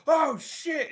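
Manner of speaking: high, loud panicked cry; startled yell. Acted. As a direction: blurt it out in shock. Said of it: "Zeke just fucking goes".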